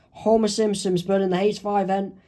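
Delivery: ordinary delivery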